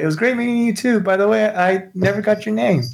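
very high, friendly sing-out; acted high-pitched farewell; calling goodbye. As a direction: call cheerily after someone leaving. Calling after departing woman while waving.

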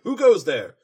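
loud challenge into the dark; loud, stern royal challenge; Luna's warning call. Retold or imitated. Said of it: "Luna warns her".

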